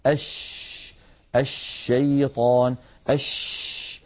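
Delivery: slow "الشيطان" and "أش" with a long, diffuse sh; stretched hushing sh in "أش، الشيطان، أش"; spreading sheen. Shown as a demonstration. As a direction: dwell on the sh in "أش" and "الشيطان". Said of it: "هكذا".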